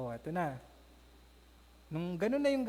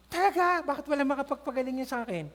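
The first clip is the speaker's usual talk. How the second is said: high, loud surprised cry; excited disbelief; 5 semitones higher, 4 dB louder than usual. Acted. Naaman hearing of a cure, eager and amazed.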